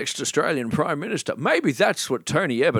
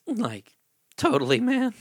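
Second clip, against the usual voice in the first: Shaggy voice; 11 semitones higher than usual. Acted. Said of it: "Shaggy and Scooby".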